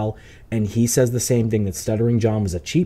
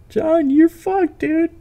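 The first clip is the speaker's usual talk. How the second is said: taunting; 4 dB louder, slower than usual. Real, not acted. Taunting the guest on stream.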